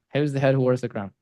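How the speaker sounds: quick and casual, half-muttered; tossed-off quote, fast. Shown as a demonstration. Coach repeating the Shakespeare line to George, fast and offhand. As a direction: rattle it off quickly, throwaway. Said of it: "You just had to quote Shakespeare".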